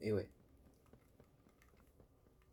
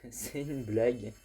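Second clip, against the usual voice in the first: slow, louder, laughing through the words; laughing disbelief; 8 dB louder, much slower than usual. Real, not acted. Gamer laughing in disbelief after dying near the end. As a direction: drag it out laughing at your bad luck.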